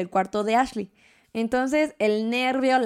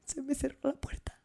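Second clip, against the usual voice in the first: much softer, embarrassed small voice; meek confession; 3 semitones higher, 12 dB quieter than usual. Retold or imitated. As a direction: admit it sheepishly, almost under breath. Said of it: "Y yo". (confesión apenada, en voz muy baja)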